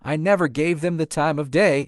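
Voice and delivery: ordinary delivery, nothing marked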